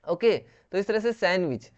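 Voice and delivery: ordinary delivery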